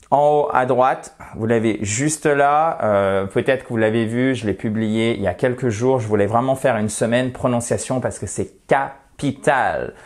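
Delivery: ordinary talk, nothing marked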